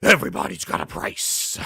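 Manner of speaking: raspy villain boast with evil laugh; Million Dollar Man. Acted. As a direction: gloat like a rich wrestling heel. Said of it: "like the Million Dollar Man. He goes".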